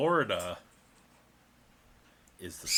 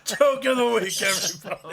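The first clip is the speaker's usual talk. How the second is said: announcer; 13 semitones higher, 9 dB louder than usual. Acted. Announcing with laughter.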